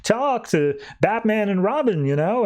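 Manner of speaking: mock calling out. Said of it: "Dick's just calling out".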